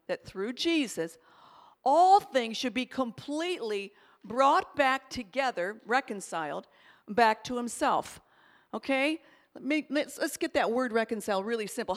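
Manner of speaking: usual speaking voice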